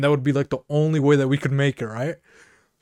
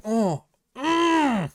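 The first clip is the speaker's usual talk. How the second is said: put-on impressed kids; 10 semitones higher than usual. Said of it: "they're going".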